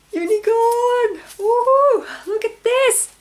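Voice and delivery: high, loud gasp and cheering; revealing the unicorn skein, squealing and cheering; ecstatic squeal. Real, not acted. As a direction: squeal with pure joy. Unveiling the second skein.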